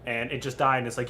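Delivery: ordinary talk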